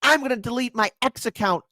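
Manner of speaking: high whiny mock voice of quitters. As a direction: mimic the whiny people quitting. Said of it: "So many people are like".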